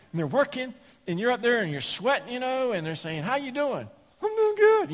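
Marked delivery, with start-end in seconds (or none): 3.2-3.9 high sing-song therapist voice; mock the cheery therapist's greeting; chirpy therapist. Preacher mimics a chirpy therapist asking how he's doing. 4.2-4.9 put-on very high, loud girly squeak; little girly voice. Preacher mimics a patient's high girly voice to the therapist. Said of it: "got that little girly voice".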